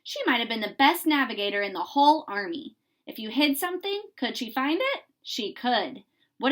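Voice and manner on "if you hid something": usual speaking voice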